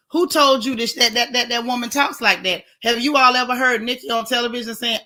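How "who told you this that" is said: ordinary delivery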